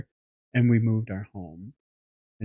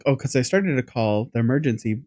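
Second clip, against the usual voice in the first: ordinary delivery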